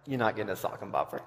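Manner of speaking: a hint of a firm mother saying no; stern mom. Mom refusing her kid the toy, lightly voiced stern no. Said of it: "my mom was like".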